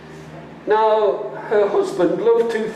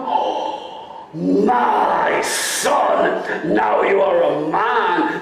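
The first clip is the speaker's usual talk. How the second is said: echoing cry; much slower than usual. Acted. Father echoes mother's cry.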